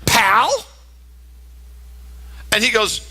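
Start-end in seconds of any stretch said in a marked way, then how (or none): 0.0-0.5 mock offended question; disbelief. Woman reading her boyfriend's card, in disbelief. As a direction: question PAL slowly, as if insulted. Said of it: "she can't believe".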